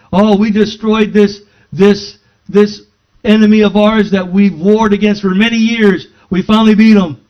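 loud, high gloating victory cry; triumphant boast. Retold or imitated. King boasting loudly to his men of victory. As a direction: crow about beating the old enemy.

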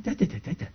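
soft, rapid babble; hushed mock run of repeated syllables; imitated speaking in tongues. Husband imitating wife unable to stop all the way home, soft babble. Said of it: "She couldn't stop".

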